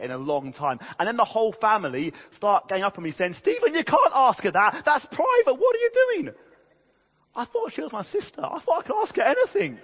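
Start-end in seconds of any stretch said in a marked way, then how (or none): 3.4-6.3 high, loud scolding of a brother; hammed-up shrill family telling-off; outraged relatives. Family ganging up on him, outraged. 7.3-9.8 very high-pitched, hammed-up protest; mock indignant pleading, pitched way up; protesting brother. His protest back to the family.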